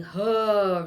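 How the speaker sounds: loud deep exhaled huh; deep birthing huh. Shown as a demonstration. Doula teaching moms the labor sound, deep huh. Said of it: "really deeply".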